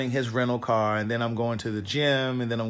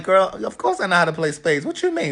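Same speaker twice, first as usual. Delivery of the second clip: sassy retort; 5 semitones higher than usual. Retold or imitated. Mock-offended retort.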